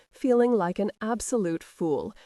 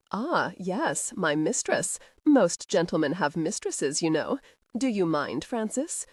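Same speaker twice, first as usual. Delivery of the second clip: usual speaking voice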